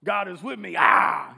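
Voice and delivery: pompous swagger. Retold or imitated. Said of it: "pompous swagger and he's like".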